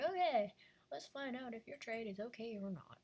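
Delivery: high, loud opening Okay, then a lightly voiced robot; robot judge. Robot referee starting a fair-trade check, high and loud. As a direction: announce it like a cheerful machine referee.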